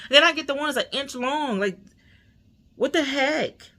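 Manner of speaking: high-pitched, indignant venting; exasperated rant. Real, not acted. Venting to viewers about the wrong needle, exasperated. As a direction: pitch it up and complain in disbelief.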